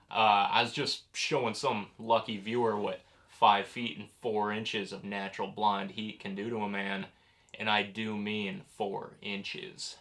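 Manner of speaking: low, soft seductive hostess purr; Dagmar's breathy vintage purr. Acted. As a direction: purr it like a vampy horror hostess. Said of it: "This is Ghost Hunter Dagmar".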